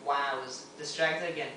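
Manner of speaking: ordinary talk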